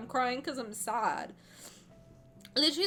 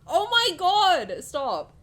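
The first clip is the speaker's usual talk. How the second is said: loud; 8 semitones higher, 11 dB louder, much slower than usual. Real, not acted. Overwhelmed reaction to card ending.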